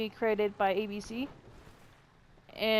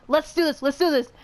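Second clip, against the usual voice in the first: raised, louder mock pep talk; rallying coach; 5 semitones higher, 7 dB louder than usual. Retelling a movie coach's words, mock rallying call. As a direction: imitate a coach urging the team on.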